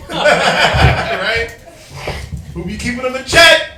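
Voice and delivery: laughing while talking. Real, not acted. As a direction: chuckle through the words. Joking about keeping wives in check.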